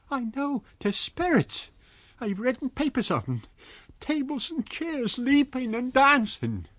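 put-on high, agitated old landlady voice; frightened landlady. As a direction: babble it out in fright.

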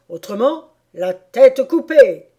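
bellowed royal threat of execution; threatening King. Acted. King threatens the Hatter with beheading.

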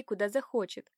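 usual speaking voice

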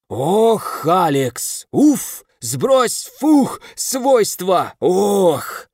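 высокие отрывистые вскрики между словами; кричать урывками сквозь корчи; вскрики в муках. A narrator's reading. Меча колбасит в бадье. (меч в бадье корчится и вскрикивает)

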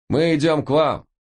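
высокий слабый крик; крикни вниз издалека; громкий крик вниз. A narrator's reading. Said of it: "крикнул Алекс". (высокий крик вниз)

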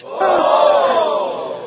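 loud high startled gasp; frightened gasp. Acted. Reacting in fright.

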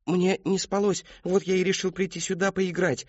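a hint of a sheepish boy; young boy. A narrator's reading. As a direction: answer sheepishly like a child. Boy Christopher answers.

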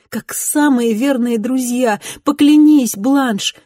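high, loud and emotional; tearful, exalted vow. Acted. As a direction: plead through tears, swearing. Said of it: "проливая слёзы".

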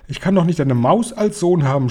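high-pitched, restrained yell; distraught yell. A narrator's reading. Father refusing a mouse for a son, distraught yell. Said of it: "schrie Mister Jenkins".

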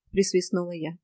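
ordinary delivery

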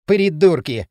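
loud, pointed contempt; expressive insult. A narrator's reading. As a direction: throw the insult pointedly after them. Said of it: "выразительно проговорил Дрын". (громко и выразительно)

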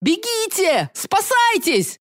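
high panicked yell, drawn out. Acted. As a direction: scream at them to run. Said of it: "заорал Лео".

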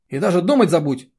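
loud, high-pitched angry order; angry bark. A narrator's reading. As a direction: bark it as a stern warning. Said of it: "рявкнул Ид".

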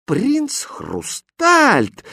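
high, drawn-out exclamation; slow, soaring disbelief; astonished. A narrator's reading. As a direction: cry the name in amazement. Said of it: "изумлённо воскликнула принцесса".

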